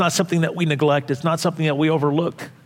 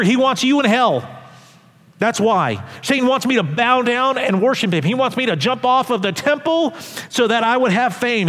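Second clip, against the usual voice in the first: raised, driving pulpit delivery pleading with Peter; impassioned preaching; 6 semitones higher than usual. Acted. Preacher voicing Jesus warning Peter, fervent preaching. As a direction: preach it hard, pressing each point home.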